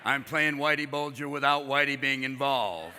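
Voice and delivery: low, lazy Nicholson drawl; Jack Nicholson impression. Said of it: "except for Nicholson. And he's like".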